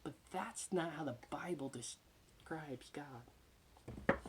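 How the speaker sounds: ordinary delivery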